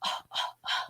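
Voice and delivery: breathy gasp copying the recorder sound; a sharp unvoiced gasp like the buzz on the tape; imitated noise. Sharing the EVP with the host, a copied gasp. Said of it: "you hear this".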